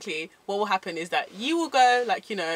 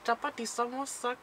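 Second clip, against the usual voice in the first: badly faked Indian accent; soft, unconvincing Indian accent; failed Indian accent; 7 dB quieter than usual. Shown as a demonstration. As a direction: attempt an Indian accent and miss. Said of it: "that was not Indian".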